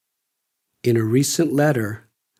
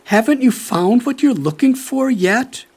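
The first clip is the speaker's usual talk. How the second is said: higher, louder, gently pleading questions; longing mother; 7 semitones higher, 4 dB louder than usual. Retold or imitated. Mother writing to her faraway son, longing questions. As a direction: ask like a mother missing her child. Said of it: "She longed to know".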